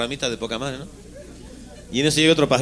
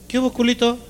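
much higher, mocking greeting; high teasing bird voice; 9 semitones higher than usual. Another bird teasing the little bird, squeaky and mocking. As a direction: tease him in a squeaky bird voice.

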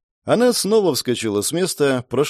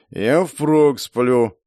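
sleepy drawl; much slower than usual. Acted. Sleepy driver dozing off.

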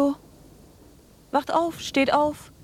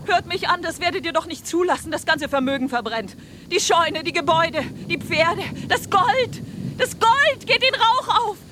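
desperate shouting; 8 semitones higher than usual. Acted. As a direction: shout to wake them and save the fortune. Anna alarms the sleeping villagers. (verzweifeltes, lautes Rufen)

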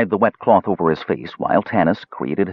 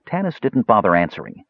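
usual speaking voice

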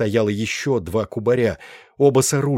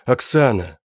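громче, с отчаянием в голосе; зовёт её, пытаясь дозваться; отчаянный зов; 3 semitones higher, 4 dB louder than usual. Acted. Зовёт умирающую подругу. (отчаянно зовёт по имени)

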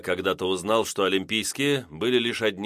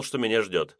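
ordinary delivery, nothing marked

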